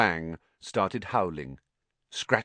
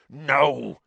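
loud, raised growl of refusal; 8 semitones higher, 6 dB louder than usual. A narrator's reading. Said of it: "growled Hagrid".

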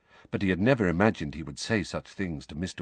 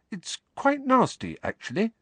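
put-on much higher girl's voice, held steady; forced calm. A narrator's reading. Hermione tells Harry, with forced calm. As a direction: Hermione keeping herself calm. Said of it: "a voice of forced calm".

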